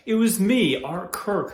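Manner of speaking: insistent. Acted. Defending himself as the one who cared for her, insistent. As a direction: push it out in protest. Acted protest of devotion.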